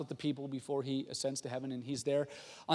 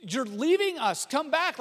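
high, loud pleading; hammed-up panicked plea; freaking out; 10 semitones higher, 6 dB louder, slower than usual. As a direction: beg after them in mock distress. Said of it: "freaking out".

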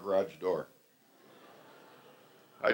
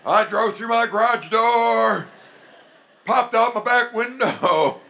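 much higher, louder and slow, laughing; exuberant rejoicing; 11 semitones higher, 8 dB louder, slower than usual. Retold or imitated. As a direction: shout it out joyfully, drawn out. Rejoicing despite disaster.

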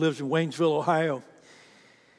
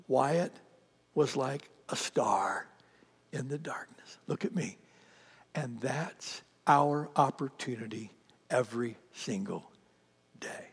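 hushed whisper; 3 semitones lower, 6 dB quieter than usual. Real, not acted. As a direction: drop to a breathy near-whisper, intimate and soft. Preacher drops to hushed close.

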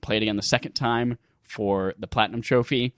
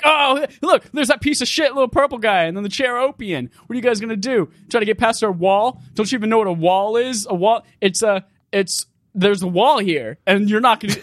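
higher, louder heckling from the guards; taunting jeer; 6 semitones higher, 4 dB louder than usual. Acted. Enemies on the wall heckle the approaching player, taunting. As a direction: jeer like a cocky sentry at an intruder.

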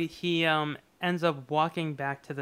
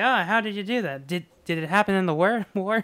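higher, louder, nosy mock questions; hammed-up soda jerk; 4 semitones higher, 5 dB louder than usual. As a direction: pester him about the war with a grin. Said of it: "and he's like".

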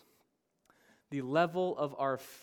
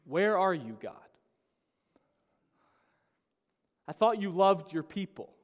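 loud, indignant challenge to God; fury at God; 4 semitones higher, 6 dB louder than usual. Acted. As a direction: demand answers, feeling let down. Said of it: "our responses are often fury over faith".

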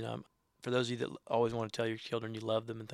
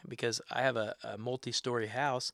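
ordinary delivery

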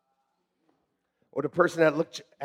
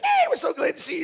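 mock cheery greeter; 11 semitones higher, 4 dB quieter, faster than usual. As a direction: overdo a greeter's welcome. Greeters at church door.

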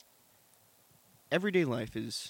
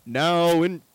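very loud, higher announcer voice; big announcer; 5 semitones higher, 12 dB louder than usual. Pretend. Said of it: "I'm also doing the big announcer voice".

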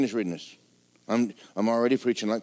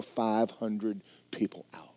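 whisper; 3 semitones lower, 6 dB quieter, slower than usual. Real, not acted. Drops to a whisper.

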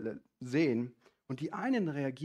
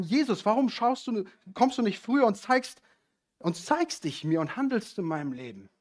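pleading believer; 6 semitones higher, 6 dB louder than usual. Retold or imitated. Believer's complaining prayer voiced.

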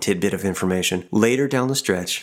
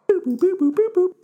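toy robot voice. Pretend. Robot computing the answer.

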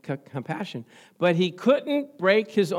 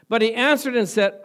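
ordinary talk

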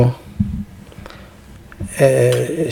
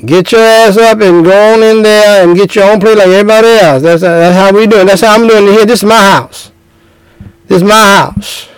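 put-on higher, bossy grandmother scolding the young husband; a stern matriarch laying down the law in her house; Big Mama scolding; 3 semitones higher than usual. Grandmother scolds the young husband at the family dinner, bossy and stern. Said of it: "And Big Mama said".

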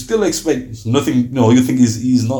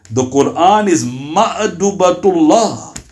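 slow solemn quote; 2 semitones higher, much slower than usual. Acted. Slow weighty quote of Prophet.

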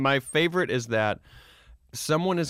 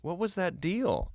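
usual speaking voice

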